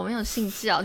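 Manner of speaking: ordinary talk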